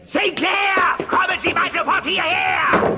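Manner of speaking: high, loud bellow for the employee; bossy shout. Acted. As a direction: yell him over, bossy and impatient. Boss summons his employee.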